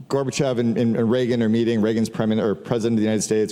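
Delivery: ordinary delivery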